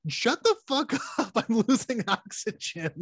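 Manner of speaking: high exasperated mock snap, laughing; mock exasperation. Cooper cutting off Dr Mann, exasperated and high. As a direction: snap at him in fake despair. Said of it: "if I'm Matthew McConaughey, I'm".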